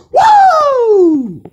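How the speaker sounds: long, high, loud "woo" of hype; over-the-top whoop. Shown as a demonstration. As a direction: let out a drawn-out, full-volume cheer. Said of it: "that was a bit over the top".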